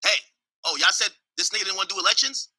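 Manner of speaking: ordinary talk, nothing marked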